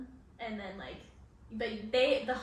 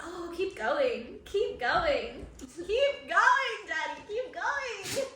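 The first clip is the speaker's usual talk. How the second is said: higher, slow laughing taunt, keep going; taunting a troll on stream, higher and laughing; teasing taunt; 6 semitones higher, 3 dB louder, much slower than usual. Retold or imitated. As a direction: egg the troll on, mocking and amused. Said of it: "And I'm like".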